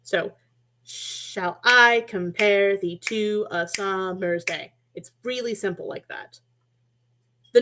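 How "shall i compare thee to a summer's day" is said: very slow walking beat through "Shall I compare thee to a summer's day"; slow, even marching scansion of "Shall I compare thee to a summer's day"; upbeat-downbeat pace. Shown as a demonstration. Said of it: "this clear pace that creates this upbeat and downbeat motion".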